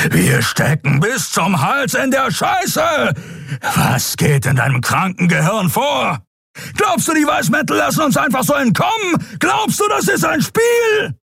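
high-pitched enraged shouting; furious roaring. A narrator's reading. Enraged pilot silences the woman he is choking, roaring. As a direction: roar accusations, pitch climbing with rage. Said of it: "Kerus Brüllen brachte sie zum Schweigen".

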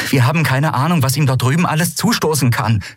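high, worried insistence; urgent argument. A narrator's reading. Captain fearing for Kai, urgent. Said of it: "fuhr Andriel fort".

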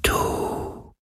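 very slow, breath-only ghostly whisper; faint whisper. A narrator's reading. The wind asking Galen who he is, faintest whisper. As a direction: whisper it like wind, each word drawn out. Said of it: "so leise wie der Schatten des Hauchs eines Flüsterns".

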